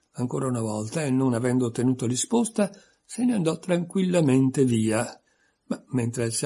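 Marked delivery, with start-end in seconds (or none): none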